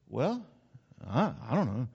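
low, soft, hesitant noncommitment; unemphatic, wavering. Shown as a demonstration. Preacher showing half-hearted commitment, soft hesitant hedging. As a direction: hedge quietly with pauses. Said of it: "haven't been quite that emphatic".

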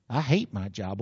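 low, sulky mock grumble; grumbling worker. Worker dreading Monday, mock sulky grumble. Congregant sulking about their job.

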